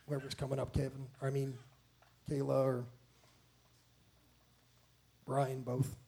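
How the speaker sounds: low, very soft offhand logistics; quiet aside. Real, not acted. Aside to whoever comes up next.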